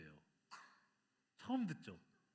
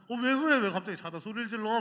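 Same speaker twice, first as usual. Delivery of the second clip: higher and louder, startled asking what's wrong; startled husband; 5 semitones higher, 6 dB louder than usual. Retold or imitated. Husband woken by his wife's scream, startled and confused. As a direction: jolt awake and ask what's wrong. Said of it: "막 이렇게 얘기를 하게 됐죠".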